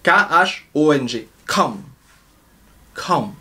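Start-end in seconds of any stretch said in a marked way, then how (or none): 1.4-3.3 "Come" low and falling; "Come" grave et descendant; Vietnamese không. Shown as a demonstration. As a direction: say "Come" softly, dropping. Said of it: "qui veut dire non".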